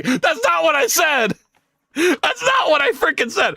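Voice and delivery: high-pitched exasperated outcry; indignant protest. Real, not acted. Protests at being misquoted.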